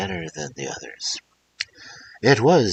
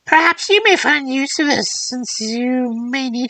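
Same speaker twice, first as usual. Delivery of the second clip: put-on old crone voice; 7 dB louder than usual. Old woman hands over the gift.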